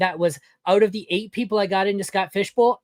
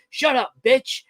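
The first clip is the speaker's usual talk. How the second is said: a lightly voiced Rock, a bit higher; Rock impression; 5 semitones higher, slower than usual. Replying to a troll, lightly voiced as the Rock. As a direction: growl it out like the Rock telling someone off. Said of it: "reply with the Rock saying".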